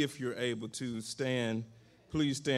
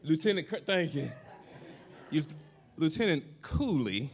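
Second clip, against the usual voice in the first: laughing teasing; 5 semitones higher than usual. Real, not acted. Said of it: "in her sergeant way".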